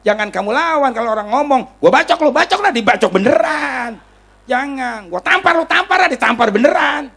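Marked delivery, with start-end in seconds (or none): 1.8-4.0 high, loud mock threat; heated imitation of a hothead; acted angry threat. 5.1-7.1 high, raised-voice mock threat; heated, imitated challenge to a fight; acted angry threat. Acting out a second threat.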